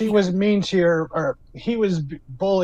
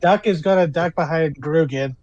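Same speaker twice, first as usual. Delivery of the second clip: ordinary talk, nothing marked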